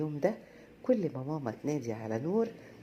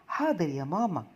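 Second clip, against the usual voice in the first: louder, eager and quick to obey; quick, eager reply; 4 semitones higher, 7 dB louder than usual. A narrator's reading. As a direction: blurt it out happily to mom. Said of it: "قال بسرعة".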